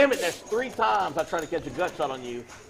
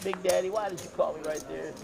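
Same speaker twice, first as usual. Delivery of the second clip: mock whiny kid; 5 semitones higher, 6 dB quieter than usual. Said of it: "mocking the losing player".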